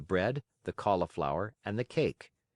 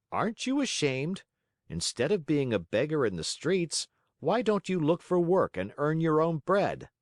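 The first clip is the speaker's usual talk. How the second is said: high-pitched reproach from passers-by; scolding passers-by; 7 semitones higher than usual. A narrator's reading. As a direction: scold a beggar in a raised pitch.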